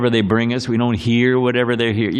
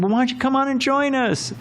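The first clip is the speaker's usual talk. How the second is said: high, hearty invitation from a partying neighbor; jolly party neighbor; 12 semitones higher than usual. Retold or imitated. Neighbor at a late birthday party inviting him over, high and hearty. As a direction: boom it out like a cheery guy with a beer.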